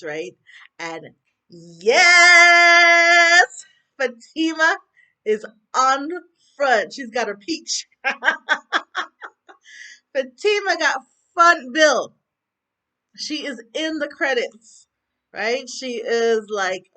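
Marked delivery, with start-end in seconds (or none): 1.5-7.9 loud, high cheer of delight; real jubilant outburst; excited celebration. Real, not acted. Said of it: "I'm, like, so happy for". 7.9-10.1 loud, high delighted laughing; real celebratory cackle. Real, not acted. Celebrating favorite character's promotion. 10.1-16.9 slow, loud, high proclamation of joy; thrilled, drawn-out cheer; excited celebration. Real, not acted. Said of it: "I'm, like, so happy for".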